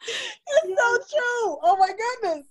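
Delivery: high, tearful, overcome; laughing through tears. Real, not acted. Author overcome with emotion at the host's memory, tearful laugh. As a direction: laugh and cry at once. Guest moved, laughing and crying.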